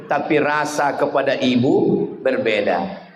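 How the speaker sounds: usual speaking voice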